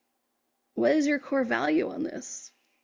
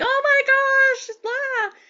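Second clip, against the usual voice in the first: much higher, louder, slow mock dismay; mock shocked gasp; 13 semitones higher, 6 dB louder, much slower than usual. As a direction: ham up the crowd's shocked groan at the fallen sign. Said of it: "and the people were like".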